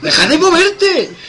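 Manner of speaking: half-laughing call, high and loud; laughing loud command; laughing shout. Real, not acted. Telling someone to stop moving.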